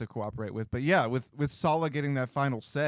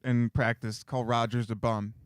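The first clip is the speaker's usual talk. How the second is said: ordinary delivery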